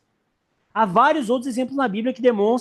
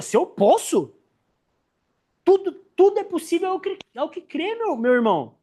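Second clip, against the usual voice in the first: higher, incredulous, then gently dropping; Jesus retorting; 6 semitones higher than usual. Retold or imitated. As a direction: toss the question back, then soften. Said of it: "olha pra ele e fala assim". (Jesus respondendo incrédulo ao pai)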